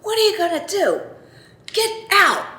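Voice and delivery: high, taunting dare at another driver; mock-furious, daring him to step out; retold road rage challenge. At a honking driver behind her, a high taunting dare. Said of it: "and I said".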